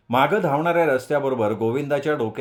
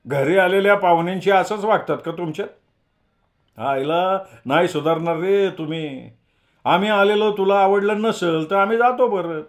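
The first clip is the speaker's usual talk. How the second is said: louder, half-joking scolding retort; snapping; 6 semitones higher, 5 dB louder than usual. A narrator's reading. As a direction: tick him off with mock offence. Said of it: "श्याम्या जगूवर डाफरला".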